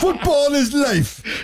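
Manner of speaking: put-on high, drawn-out shout in a silly accent; Ted Lasso-style accent. Host quoting Ted Lasso to co-hosts, high hammed-up accent. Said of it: "I watch way too much Ted Lasso".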